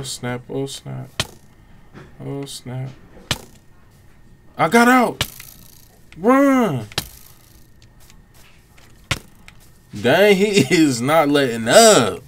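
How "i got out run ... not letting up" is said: higher and louder, thrilled; high, loud excited panic; excited yelling. Real, not acted. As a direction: shout it out while fleeing. Fleeing enemy, excited shouts.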